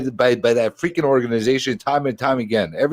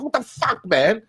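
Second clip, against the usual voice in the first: exasperated outburst; 9 semitones higher than usual. Retold or imitated. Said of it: "I'm like".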